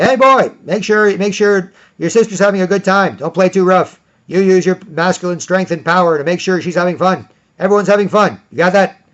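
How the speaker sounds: loud, high instructing call; calling to the boy. Retold or imitated. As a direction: call over the playground to the boy. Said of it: "I'll be like".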